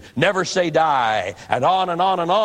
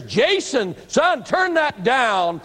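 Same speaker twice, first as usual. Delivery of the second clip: raised, slow call into the dark; a father's mild retold call, higher and slow; 7 semitones higher, much slower than usual. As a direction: call down the stairs to the son. Father calling son down basement.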